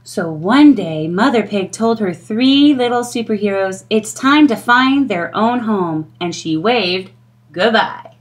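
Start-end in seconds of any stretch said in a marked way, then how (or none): none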